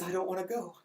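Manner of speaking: a hushed, imitated dying farewell; sad farewell. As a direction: let it fall away, near silent. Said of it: "and then he says".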